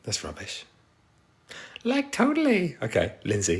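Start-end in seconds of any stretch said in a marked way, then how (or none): 1.8-2.8 very high mock Valley Girl; Valley Girl accent. Streamer taking a viewer's suggested phrase, high mock Valley Girl. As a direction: pitch it way up, badly faked Valley Girl. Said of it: "Do a Valley Girl accent".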